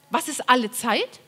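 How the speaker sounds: usual speaking voice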